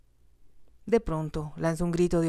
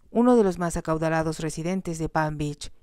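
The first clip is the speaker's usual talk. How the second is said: usual speaking voice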